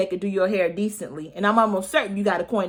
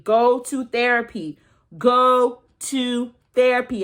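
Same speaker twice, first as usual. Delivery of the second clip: slow, pressed go to therapy; emphatic urging; 2 semitones higher, 4 dB louder, slower than usual. Shown as a demonstration. Vlogger urging viewers, slow and emphatic. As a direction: urge it slowly word by word. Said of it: "I am an advocate for therapy".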